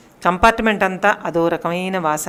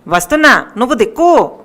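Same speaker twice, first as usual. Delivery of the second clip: louder shout back; 4 semitones higher, 6 dB louder than usual. A narrator's reading. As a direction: call back across the crowd. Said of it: "గట్టిగా అరిచాడు". (గట్టిగా అరుపు)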